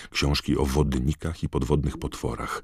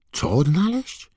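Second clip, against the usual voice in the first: confused question; 13 semitones higher, 4 dB louder than usual. A narrator's reading. Harry confused.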